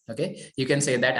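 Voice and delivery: ordinary talk, nothing marked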